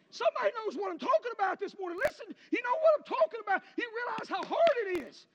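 loud, high preacher's cry; full-force preaching. Acted. Preacher thundering at his congregation, loud and high. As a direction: drive it home to the congregation.

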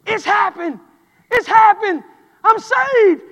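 high, loud, overjoyed cry; boy crying out he's saved; joyful cry. Retold or imitated. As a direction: shout the news of salvation. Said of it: "he screams out".

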